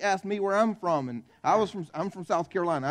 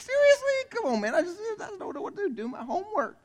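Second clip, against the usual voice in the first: high-pitched whiny protest with a laugh; exasperated kid; 13 semitones higher than usual. Retold or imitated. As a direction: play a fed-up schoolboy pleading, much higher. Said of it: "I'm like".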